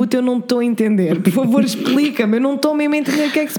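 imitating a confused Vine character; confused man. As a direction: act baffled and pleading.

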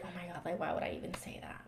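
quick unvoiced whisper; whispered mock regret. Friend imitating a contestant whispering regret. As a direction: whisper it like a hurried confession. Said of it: "be like".

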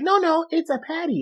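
high, soft mock correction; imitated woman in documentary. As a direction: lightly voice her, pitched well up. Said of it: "And she's like".

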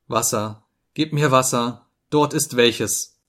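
usual speaking voice

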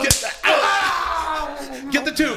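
shout. Acted. Hazing scene.

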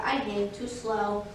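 slow, subdued tortoise voice; tortoise character. Acted. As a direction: let the words sag like a tortoise. Tortoise's excuse, slow and low.

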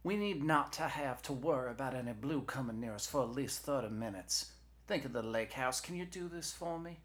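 high, coaxing persuader; Jesse. Acted. Knee coaxes dispatcher into a favor, high and persuasive.

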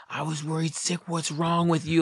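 high-pitched mock telling-off; imitated scolding mom. Mom picking up her stoned kid, imitated scolding. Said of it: "she's yelling at me".

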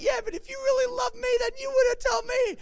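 a needy bride nagging her groom; whiny bride. Retold or imitated. The bride nags Samson for the answer in a high, whiny put-on voice.

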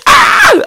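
high shocked shriek; shrill scream. Acted. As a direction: let out a sudden squeal.

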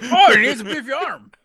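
loud, high line cracking up with laughter; laughing. Real, not acted. Player laughing at the fly with beefy arms, loud and high. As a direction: blurt it out through big laughter.

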